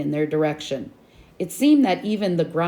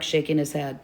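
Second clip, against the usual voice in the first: usual speaking voice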